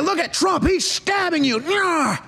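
high, squawking put-on accusation; mock accuser. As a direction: blame someone else in a hammed-up high voice. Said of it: "They're like".

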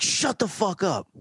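high angry retort; cop snapping. Retold or imitated. Cop snapping at the kid to shut up, high and angry. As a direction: shut him down like a hothead cop.

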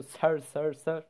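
"Sir" kelimesini İngilizce gibi, yabancı bir tınıyla tekrarlıyor; "sir, sir, sir" diye hocaya hitabı taklit ederek; saying sir. Shown as a demonstration. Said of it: "Sir diyoruz yani burada". (hocaya "Sir" diye hitap edişi taklit ederek, yabancı bir tınıyla)

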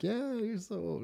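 high, soft sugary mock reply; fake sweet agreement. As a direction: smile it out, teasing sweetly. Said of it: "I just smile and be like".

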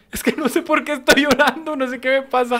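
high-pitched mock bawling; laughing and crying. Telling his wife he can't explain it, mock crying. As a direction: imitate a bewildered, laughing cry. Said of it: "me estaba riendo porque yo estaba llorando".

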